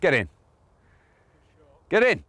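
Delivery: willing the putt to drop, pitch up; urging the ball. Real, not acted.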